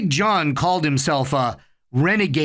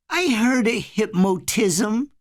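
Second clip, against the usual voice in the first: put-on sulky child; 7 semitones higher, slower than usual. A narrator's reading. Child sulking.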